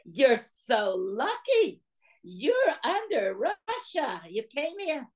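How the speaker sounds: lightly voiced Paris shop owner, high and slow on "You're so lucky"; a hint of a wistful storekeeper, drawn out and high; shop owners quoted. Storekeeper telling her father he's lucky, a lightly acted envious voice. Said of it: "And they'd say".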